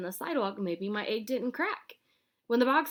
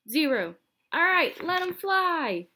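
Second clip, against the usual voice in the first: high, loud, drawn out go signal; high slow let 'em fly; excited launch call; 8 semitones higher, 6 dB louder, slower than usual. Acted. Launch countdown ending.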